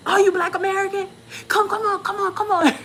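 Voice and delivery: high, excited beckoning; eager welcome. Retold or imitated. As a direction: usher them in with rapid, eager urging. Acts eager hosts beckoning.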